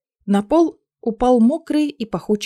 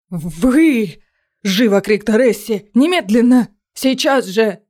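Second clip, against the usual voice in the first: angry order; 4 dB louder, slower than usual. Acted. Magister orders her out.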